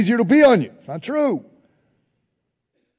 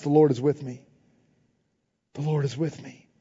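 quiet confession; 4 semitones lower, 6 dB quieter than usual. Acted. Preacher voicing the believer, quiet confession. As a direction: lower and softer, fading.

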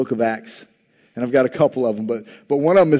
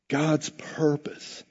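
whisper; 8 dB quieter, much slower than usual. Acted. Dramatic whispered emphasis.

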